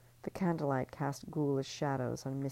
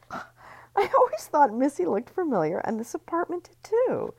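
fake delight; 13 semitones higher, 7 dB louder than usual. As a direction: gush falsely, as if thrilled. [name] plays along.

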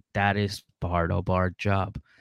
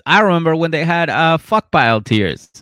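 higher, louder, fast nostalgic jab; quick loud mock comment; mock fan comment; 6 semitones higher, 8 dB louder than usual. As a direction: rattle it off like an amused commenter. Imagined commenter.

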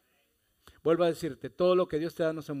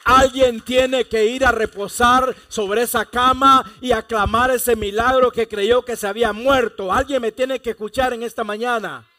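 full-force preaching; 7 semitones higher, 11 dB louder than usual. Real, not acted. Pastor calls the congregation to claim their miracle, loud fervent preaching. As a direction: cry it out over the music, high and loud.

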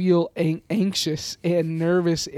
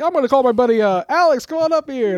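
stand-up host; 8 semitones higher, 6 dB louder than usual. Pretend.

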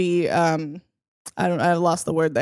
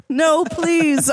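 higher-pitched mock plea; begging; 7 semitones higher than usual. A narrator's reading. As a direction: beg in a hammed-up storytelling voice. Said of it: "I begged".

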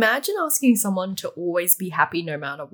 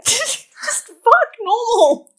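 laughing; 13 semitones higher, 6 dB louder, much slower than usual. Real, not acted. Laughing at the sex scene.

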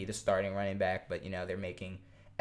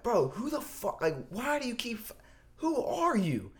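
high-pitched, halting disbelief; mock-indignant and stumbling over the words; retold exasperation; 10 semitones higher than usual. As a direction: act out confronting a pest, voice pitched up. Retold argument with pestering fan.

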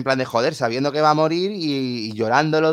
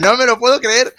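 high, louder astonished exclamation; retold disbelief; 9 semitones higher, 5 dB louder than usual. Fan tells co-host of recognising the music, amazed. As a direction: re-enact delighted disbelief out loud.

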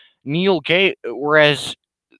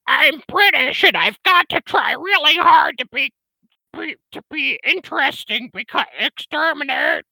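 very high, squeaky robot voice; mock the Dalek as a shrill, self-important machine; screechy Dalek parody. Ranting about Doctor Who to his viewers, squeaky Dalek parody. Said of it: "with an annoying, screechy voice".

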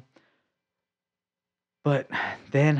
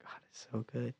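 very soft, almost whispered praise; hushed awe; 18 dB quieter than usual. Retold or imitated. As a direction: murmur it in quiet awe. Awed reply, retold.

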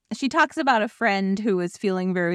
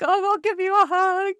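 high, sweet comforting voice; tender offer; 11 semitones higher, 3 dB louder than usual. Retold or imitated. Imitating her friend Yessie offering a hug, high and sweet. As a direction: coo it like a caring friend. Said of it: "she's like".

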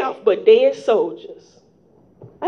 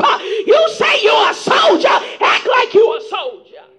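preaching; 7 semitones higher than usual. Acted. Preaching run with shouts.

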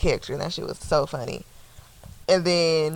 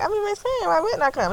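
high, sugary mock of a friend. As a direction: pitch it way up, fake sweet. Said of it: "And then she's like".

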